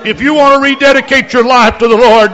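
loud, high fervent altar call; preaching altar call. Real, not acted. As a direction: urge them forward with full force. Altar call at full force.

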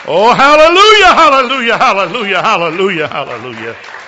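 loud high hallelujah fading away; ecstatic hallelujahs. Real, not acted. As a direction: burst out praising, then trail off. Ecstatic praise after report.

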